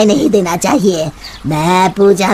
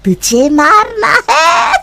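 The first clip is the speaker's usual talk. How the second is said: high slow menacing witch voice; river witch threatening the passerby; witch threat; 10 semitones higher, slower than usual. Acted.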